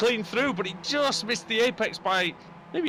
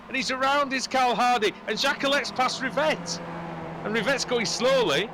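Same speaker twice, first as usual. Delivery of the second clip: high-pitched urgent race commentary; thrilled motorsport commentator; excited play-by-play; 6 semitones higher than usual. Real, not acted. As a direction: call each incident as it happens. Live play-by-play.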